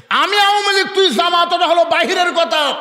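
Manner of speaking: high, sustained preacher's shout; ringing high declamation; full-force preaching. Real, not acted. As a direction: drive the point home to the crowd.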